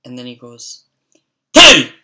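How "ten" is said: sudden explosive high yell; startling full-force shout; yelled name. Retold or imitated. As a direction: bellow it out so it makes them jump. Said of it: "the way he fucking yelled my name".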